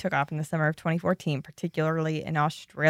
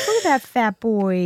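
cooing at a dog; 5 semitones higher, 3 dB louder, slower than usual. Retold or imitated. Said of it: "they'll be like".

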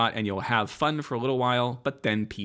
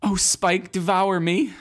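higher, louder mock swoon; hammed-up breathless plea; swooning Buffy; 8 semitones higher, 4 dB louder, slower than usual. Buffy bot begs Spike in a hammed-up higher, louder swoon.

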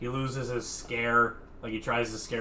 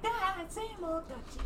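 mock monster voice; 6 dB quieter than usual. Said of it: "he's like".

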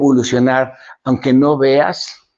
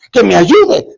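high, louder mock plea; acted pleading, pitched up; insistent pleading; 6 semitones higher, 5 dB louder, slower than usual. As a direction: insist on it like a stubborn petitioner.

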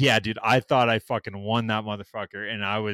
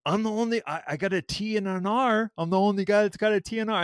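higher, fast indignant protest; retold protest; 7 semitones higher than usual. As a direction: argue your case in a rush. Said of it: "And then I'm like".

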